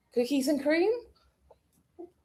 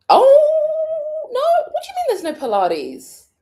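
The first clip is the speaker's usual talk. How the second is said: high, loud cry of dismay; higher and louder, a shocked no Pilates; dismayed surprise; 7 dB louder, slower than usual. Real, not acted. Vlogger finds no Pilates class available, high dismay. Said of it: "I'm so confused".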